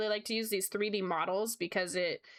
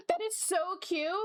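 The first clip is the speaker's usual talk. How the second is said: excited gasp; 9 semitones higher than usual. Real, not acted. Gasping at cute fan art.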